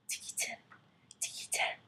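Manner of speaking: soft unvoiced tikitin; tiny whisper sound. The mouse calls softly from the garden.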